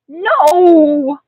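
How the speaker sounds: high, loud drawn-out cry; child's loud refusal; yelled protest. A narrator's reading. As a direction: yell it in alarm. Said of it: "I yelled".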